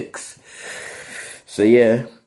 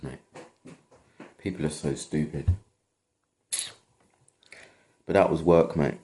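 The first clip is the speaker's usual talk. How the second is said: ordinary talk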